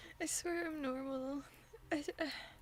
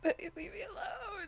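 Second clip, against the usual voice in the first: near tears; 6 semitones higher than usual. Real, not acted. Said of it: "I'm gonna start crying for real".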